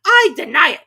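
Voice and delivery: high, loud denial; high, loud Hare; indignant March Hare. A narrator's reading. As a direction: deny it flatly. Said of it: "said the March Hare".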